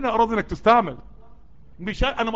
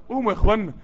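normal talking voice

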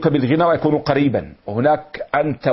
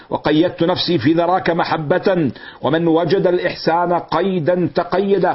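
slow, measured verse delivery; poem recitation; 4 semitones higher than usual. Acted. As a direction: recite it like classical poetry, drawing out each word. (إلقاء شعري متأنٍ)

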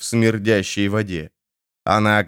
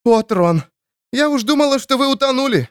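relieved cry. Acted.

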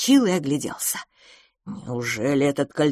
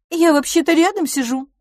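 put-on higher, louder pretty girl's voice; a sweet young lady butting in, higher and louder; lovely young woman's voice; 12 semitones higher, 6 dB louder than usual. A narrator's reading. The young beauty reminds the men she is there, in a high sweet voice. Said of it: "своим прекрасным голосом".

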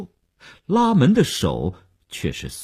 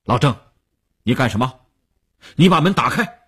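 sharp order building to a loud, high shout; stern command; 3 semitones higher, 4 dB louder, faster than usual. A narrator's reading. Boss ordering the aide blocking the door, stern shout. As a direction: demand it severely, loudest at the end. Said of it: "吴长天厉声喝令".

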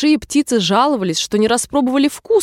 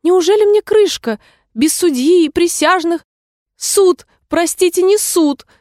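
high-pitched, anxious little mouse; small scared creature, voice pitched way up; timid; 9 semitones higher, 4 dB louder than usual. A narrator's reading. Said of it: "Робко молвила мышка".

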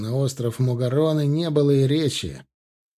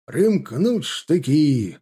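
slow, drawn-out military order; battle command; 2 semitones higher, much slower than usual. A narrator's reading. Alexei orders jaegers to fix bayonets, commanding. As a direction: stretch each word as a command to the ranks. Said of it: "скомандовал Алексей".

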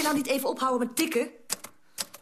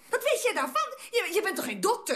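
higher-pitched, indignant retort; indignant challenge; 6 semitones higher than usual. Acted. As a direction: challenge them, pitch jumping up. Acted quarrel, challenging the other.

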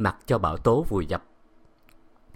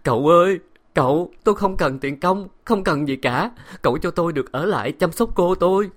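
a higher, louder weeping maid; tearful plea; 8 semitones higher, 5 dB louder than usual. A narrator's reading. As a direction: beg through tears. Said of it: "hai hàng nước mắt chảy dài".

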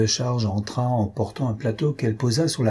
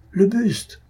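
alarmed shout; 10 semitones higher than usual. A narrator's reading. A student shouting to the others at the ghastly bust, full alarm. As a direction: let the second call ring out in alarm. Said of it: "une voix s'écria".

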